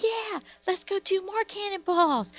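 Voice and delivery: very high, squealing mock kid voice; rowdy screaming kids. Neighbor mocking loud kids at the pool, high rowdy squeal. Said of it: "And they're so loud".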